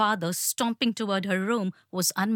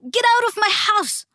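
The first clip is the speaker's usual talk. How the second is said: much higher and louder shriek, "out" ringing high and falling; 13 semitones higher, 7 dB louder than usual. A narrator's reading. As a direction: scream "Get out of my house!" at full force. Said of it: "her mom shrieked".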